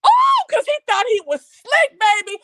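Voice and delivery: high loud gloating call-out; loud and high, relishing it; gloating shout. Real, not acted. Gloating about the villain.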